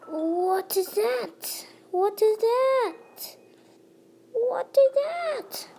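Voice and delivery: building wonder. Pretend. Player puzzling over a strange sight, building wonder. Repeats question, climbing each time.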